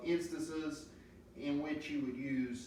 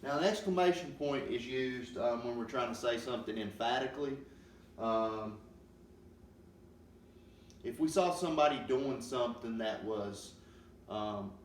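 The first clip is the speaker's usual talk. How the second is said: ordinary delivery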